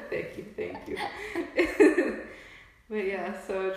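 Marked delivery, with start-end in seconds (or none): 0.1-1.0 laughing thanks. Real, not acted. Co-host thanking friend for a shout-out, laughing hard. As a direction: thank them while cracking up.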